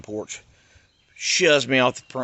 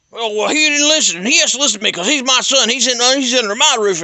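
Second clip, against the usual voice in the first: high, louder whiny father voice; mocking his dad; 11 semitones higher, 8 dB louder than usual. Dad argues with the cop.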